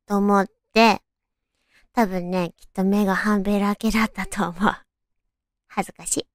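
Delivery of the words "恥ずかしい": embarrassed murmur. Real, not acted.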